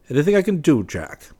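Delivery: high, loud offer, dropping on the name; calling out. A narrator's reading. West offering Jack help as he leaves, calling out. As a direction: call the question out high and loud.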